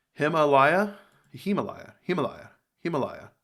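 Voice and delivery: Himalaya repeated, testing HIM at the start; practising the Himalaya pronunciation; trying out Himalaya. Shown as a demonstration. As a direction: sound out Himalaya several ways. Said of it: "Him? Him. It's him, right?".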